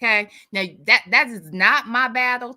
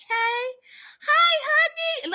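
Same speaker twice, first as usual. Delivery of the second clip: high sing-song greeting; much slower than usual. Real, not acted. Greeting a viewer in chat.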